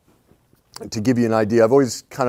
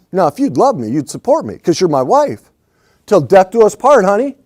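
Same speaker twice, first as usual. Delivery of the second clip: louder, higher mock husband pleading his case; demanding husband; 7 semitones higher, 8 dB louder than usual. Husband pushes his wife to back his absurd plan, loud and mock-demanding. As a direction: ham up an entitled husband pressing his wife.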